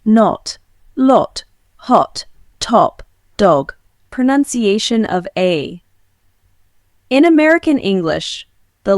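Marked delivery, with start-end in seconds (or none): 0.1-3.7 British accent, rounded O in "not, lot, hot, top, dog", slow; British accent: round the lips on each "not"; British accent O demo. Shown as a demonstration. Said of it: "But in British English, we say".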